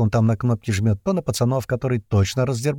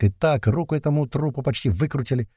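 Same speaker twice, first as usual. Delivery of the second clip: usual speaking voice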